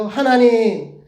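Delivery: put-on high, loud child's reply; acted eager answer from a class; kindergartner answering. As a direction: call it out like a small pupil. Children answering the teacher in chorus.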